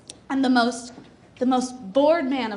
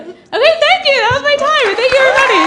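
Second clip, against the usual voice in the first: excited sign-off; 11 semitones higher, 9 dB louder than usual. Real, not acted. Comic signs off to applause.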